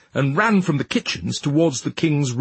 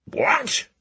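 shout; 13 semitones higher than usual. A narrator's reading. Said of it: "shouted the king".

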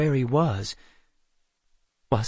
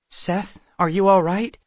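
higher-pitched anxious question; worried concern; 7 semitones higher, 4 dB louder than usual. A narrator's reading. Kendra checking on her dazed brother, worried concern. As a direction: check on him with mild alarm.

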